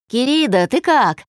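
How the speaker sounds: high, loud, perky greeting; cheerful. A narrator's reading. Friend rings Dasha to check in, cheerful. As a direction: greet her brightly with a lilt. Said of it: "Бодрый голос заставил её улыбнуться".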